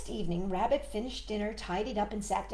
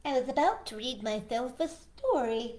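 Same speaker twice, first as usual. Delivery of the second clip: lightly voiced polite rabbit, a bit higher; a gentle rabbit, mildly put out; 3 semitones higher than usual.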